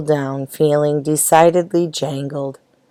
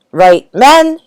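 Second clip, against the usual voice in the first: higher, louder order to the troops; commanding; 8 semitones higher, 4 dB louder than usual. A narrator's reading. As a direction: bark it like a sergeant. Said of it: "he commanded".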